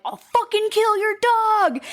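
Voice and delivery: high, drawn-out mock scream; acting out a screaming threat; imitated scream. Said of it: "she was screaming".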